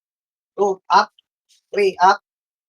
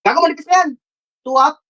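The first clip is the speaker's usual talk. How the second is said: high, pressing game calls; high-pitched frantic callouts; urgent excited shotcalling; 9 semitones higher than usual. Real, not acted. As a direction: yell the plays to your team, keyed up. Frantic shotcalling in ranked match.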